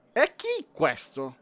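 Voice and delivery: slow, strange penguin voice; odd penguin voice. Acted. Penguin queen questions the intruder, odd slow voice.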